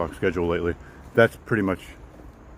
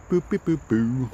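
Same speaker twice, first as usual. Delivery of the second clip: robot beeps; 5 semitones higher, slower than usual. Pretend. Imitates robot beeping noise.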